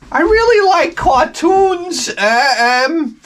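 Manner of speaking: squeaky shy geek; goofy high voice. Pretend. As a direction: play a bashful dork mumbling his answer. Acting a shy cartoon fan.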